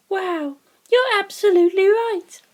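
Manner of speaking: Sugimoto's voice. Acted. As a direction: lift into a bright girlish voice for her. Narrator voices Sugimoto's lines.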